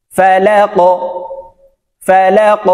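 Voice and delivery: "ফা লা ক" with the ক pushed upward; upward qalqala. Shown as a demonstration. Teacher leading practice of "ফা লা ক", upward qalqala. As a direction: end "ফা লা ক" on a rounded bounce. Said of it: "কফ উপরের দিকে ধাবিত করে".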